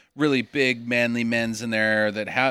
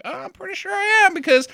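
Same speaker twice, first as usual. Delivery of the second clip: high, fast smug brag; high cocky voice; 14 semitones higher, 4 dB louder, faster than usual. Retold or imitated. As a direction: squeak it out cocky. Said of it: "he's like".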